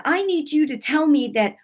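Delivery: high, mad-and-tearful plea, reenacted; retold upset demand. Wife demanding reassurance from husband, retold upset. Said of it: "I was crying, but I was mad".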